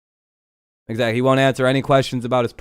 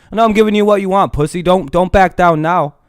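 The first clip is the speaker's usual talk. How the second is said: raised, goading taunt at a troll; taunting yell; 5 semitones higher than usual. Real, not acted.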